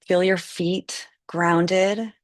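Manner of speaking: ordinary talk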